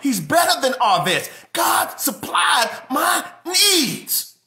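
high, loud, ringing praise building to a shout; full-force preaching. Acted. Pastor telling the congregation God beats any bank, shouting. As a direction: lift the church with every "better".

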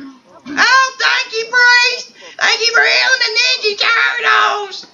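high-pitched, hammed-up cry of thanks; grateful gushing. Thanking priest for healing the turtles, high gushing shout. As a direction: gush loudly in a high put-on voice.

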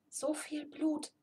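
slow, soft unvoiced whisper; a slow shocked whisper. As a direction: breathe it out in shock. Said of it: "flüsterte Pintel".

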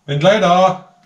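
calling out loudly; from another room. Real, not acted. Calling to someone elsewhere.

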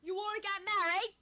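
put-on high loud Cockney accent; hammy Cockney charmer. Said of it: "the Cockney accent".

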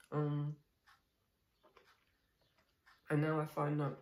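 eating breakfast while speaking; talking while chewing. Real, not acted. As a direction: mouth full of food. Seen 2: spoon enters mouth at 0.1, then lips closed, chewing through 3.7.

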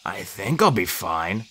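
slow, soft and low; injured old mentor, hushed; hurt, weakly reassuring. A narrator's reading. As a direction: say it carefully through pain. Injured centaur reassures, in pain.

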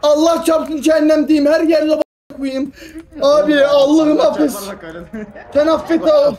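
loud, slow, desperate cries; panicked shouting. Real, not acted. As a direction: wail in panic at dying. Player dies, shouts in panic.

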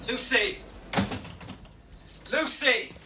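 high, urgent call of a name. Acted. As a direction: call out to find someone. Calling out for Lucy.

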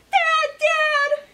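high, loud scared call for dad; frightened child calling dad; panicked call. Acted. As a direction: cry out for dad in fear. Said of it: "he shouted".

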